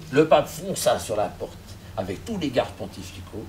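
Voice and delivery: ordinary delivery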